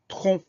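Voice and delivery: "tronc" said with the final C silent. Shown as a demonstration. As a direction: say "tronc" ending on the nasal "on", no C sound. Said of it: "le C qu'on n'entend pas".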